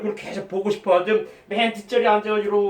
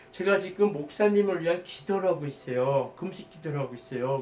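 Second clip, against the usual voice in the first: much softer, fading lower; hushed confession; 4 semitones lower, 8 dB quieter than usual. Retold or imitated. A man telling the pastor he's been fasting for her, hushed. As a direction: tell it gently, almost under your breath.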